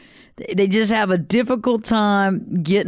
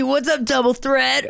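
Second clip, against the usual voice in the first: hyped buddy greeting; 5 semitones higher than usual. Shown as a demonstration. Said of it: "When you see".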